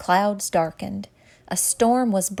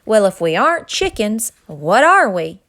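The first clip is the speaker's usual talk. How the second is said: loud question; 8 dB louder than usual. A narrator's reading. The gang asks loudly.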